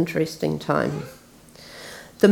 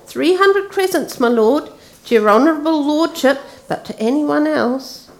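much higher, fawning slaver's voice; a slaver haggling with a lord, wheedling high; 9 semitones higher than usual. Acted. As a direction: flatter him while naming the price. Slaver Pug naming his price.

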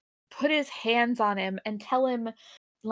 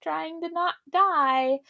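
slow, higher-pitched mock advice; mock encouragement; 6 semitones higher, slower than usual. Podcaster mimicking Spock's unhelpful pep talk, mock encouragement. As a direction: draw it out high, as useless advice.

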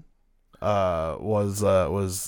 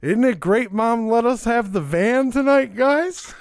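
mock yell in a much higher voice; retold yell; 11 semitones higher than usual. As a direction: call it out high, imitating the buddy. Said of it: "just yells out".

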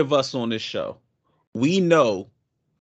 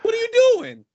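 very high, loud incredulous question; mock disbelief; 7 dB louder, faster than usual. Scolding a friend acting out of character, high mock disbelief. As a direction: squeal it in mock dismay at a friend.

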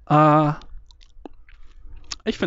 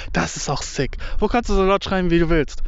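ordinary talk, nothing marked

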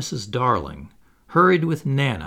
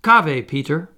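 louder, urgent warning call; 3 semitones higher, 6 dB louder than usual. A narrator's reading. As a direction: shout the alarm to Peter. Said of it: "called out".

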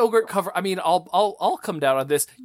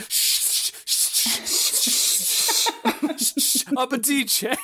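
rhythmic shushing; 7 semitones higher, much slower than usual. Retold or imitated. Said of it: "it sounds like you're shushing us".

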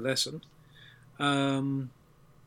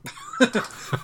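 high-pitched laugh, louder than speech; burst of laughter; 6 dB louder than usual. Real, not acted. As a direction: break up laughing when called on. Laughing when called on.

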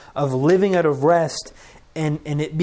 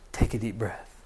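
God gently calming; 6 semitones lower, 6 dB quieter than usual. Retold or imitated. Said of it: "He grabs our face and He looks at us and He says".

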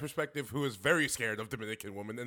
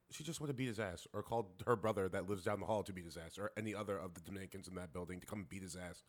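ordinary talk

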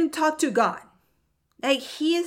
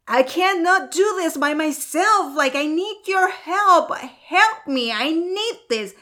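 higher-pitched, insistent cry for help; desperate plea; 5 semitones higher, 4 dB louder than usual. Retold or imitated. Praying to God with a breaking heart, desperate plea. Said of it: "your heart, it's breaking".

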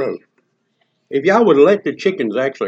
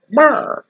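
higher mock chicken chirp; chicken brr; 11 semitones higher, 3 dB louder than usual. Chicken answering the cop, a higher mock chirp. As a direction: chirp back like a stubborn chicken. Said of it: "chicken goes".

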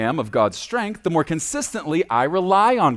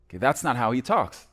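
usual speaking voice

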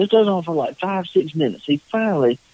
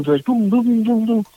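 mimicked gunshots; 8 semitones higher than usual. Imitating rapid gunshots.

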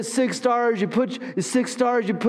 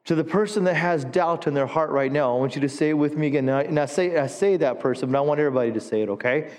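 sermon preaching; 5 semitones lower than usual. Acted. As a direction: preach it, leading the church in response. Preaching, call and response.

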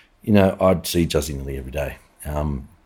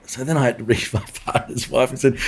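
ordinary talk, nothing marked

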